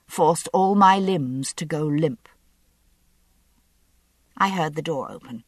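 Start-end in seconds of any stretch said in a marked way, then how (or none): none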